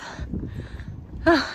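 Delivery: high breathy panting exhale; winded sigh. Real, not acted. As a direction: gasp out the effort. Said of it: "I'm out of breath".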